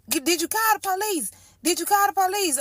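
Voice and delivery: imitating mom's frantic questions on the phone; panicked mom. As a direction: repeat it fast like a frantic mom.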